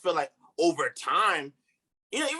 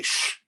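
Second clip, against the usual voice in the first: teeth-suck demo. Shown as a demonstration. Demonstrating the teeth-sucking sound.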